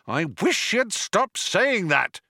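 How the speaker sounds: high, slow-paced cry of irritation; exasperated shout. A narrator's reading. Ford fed up with Arthur's doom, exasperated cry. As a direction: raise the pitch, fed up. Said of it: "shouted Ford".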